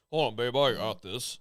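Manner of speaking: a hint of macho tough guy; lightly voiced cocky macho man; Macho Man impression. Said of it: "Like Macho Man over here".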